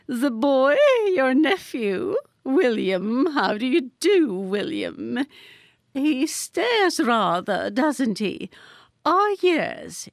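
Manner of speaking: high, gracious society lady voice; gracious grand lady. A narrator's reading. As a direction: beam it out like a gracious dame.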